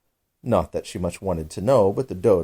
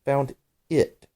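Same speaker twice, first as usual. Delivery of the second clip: crossly; 7 semitones higher than usual. A narrator's reading. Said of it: "the mouse replied rather crossly".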